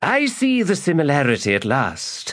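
lightly voiced sly old man; sly magus. Magus speaking to lady.

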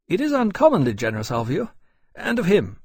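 high-pitched, emphatic thanks; grateful courier captain. A narrator's reading. James thanks his host warmly.

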